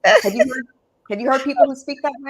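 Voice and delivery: every phrase lilting up like a question; playful mock uptalk, fast; uptalk demo. Shown as a demonstration. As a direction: push it fast with rising questioning ends. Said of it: "sounds like a question".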